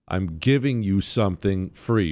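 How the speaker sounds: ordinary delivery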